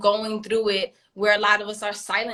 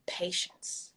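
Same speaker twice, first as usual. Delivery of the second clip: breathy whisper, much softer; 12 dB quieter than usual. Real, not acted. Mom describing her husband, whispering patience. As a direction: breathe the word patience almost silently.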